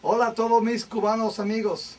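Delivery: put-on English accent doing Spanish. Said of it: "that's an English accent doing a Spanish voice".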